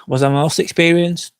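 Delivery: normal talking voice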